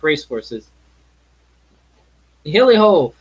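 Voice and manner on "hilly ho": a hearty hollered hail, higher and louder; jolly cry. Acted. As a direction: whoop to start the party. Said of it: "cried old Fezziwig".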